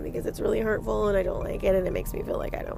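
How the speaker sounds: soft, rushed mock whimpering plea; meek complaint. Host mocking a timid reply to the inner voice, meek and rushed. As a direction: plead weakly in a hurried little voice. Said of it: "don't want to just be like".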